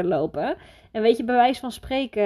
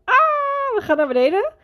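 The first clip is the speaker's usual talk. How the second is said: high, louder shriek on the drop; on a roller coaster; 5 dB louder than usual. Retold or imitated. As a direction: yell it out high as the ride plunges. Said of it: "En je gaat omhoog en je denkt".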